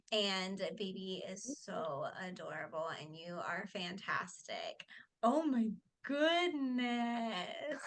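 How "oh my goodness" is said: real cooing delight; adoring gush. Real, not acted. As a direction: gush slowly, melting at a newborn. Gushing on first seeing the baby.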